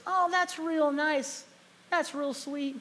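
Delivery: high, slow, drawn-out sweet talk; flirty saleswoman.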